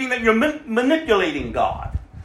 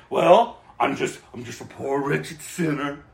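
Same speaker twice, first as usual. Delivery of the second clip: self-pitying whine; 7 semitones lower, 5 dB quieter than usual. Retold or imitated. Preacher mocking self-pity of believers, mopey whine. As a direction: moan it like a pitiful wretch. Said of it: "we're not just to be identifying".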